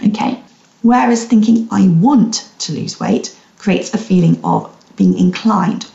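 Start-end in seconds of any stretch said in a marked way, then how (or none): 1.7-3.4 "want" drawn out slowly; stress on want. Shown as a demonstration. Coach contrasts thoughts for listeners, slow stress on "want". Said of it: "the emphasis on the word want".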